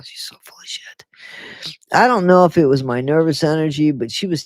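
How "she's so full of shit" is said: breathy whisper; whispered aside. Real, not acted. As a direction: mutter it under your breath.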